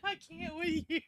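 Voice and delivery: high, soft voice through giggles; too tickled to talk properly; helpless laughter. Real, not acted. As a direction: squeak it out quietly while laughing. Helpless laughter at crude rhyme.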